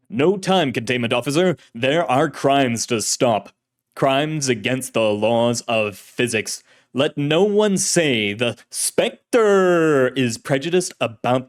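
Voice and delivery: loud, slow melodramatic crusader; old-time radio hero. Acted. Shadowy hero lectures containment officer on crime, booming radio-serial voice. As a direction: declaim grandly, stretching the hero's name. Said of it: "voice was like an actor in an old-fashioned serial".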